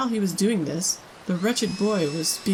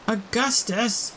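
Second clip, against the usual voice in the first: shouting; 4 semitones higher, slower than usual. A narrator's reading. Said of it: "shouted Missus Gloop".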